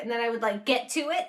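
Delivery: ordinary delivery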